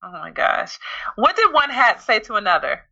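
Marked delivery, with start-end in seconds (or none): none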